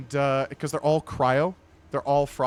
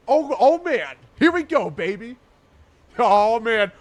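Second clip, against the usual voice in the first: high, loud hype; hyped-up hollering; 8 semitones higher, 10 dB louder, slower than usual. Pretend. Streamer reacting to Fortnite mobile gaming, hyped-up hollering. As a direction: whoop it up as if thrilled.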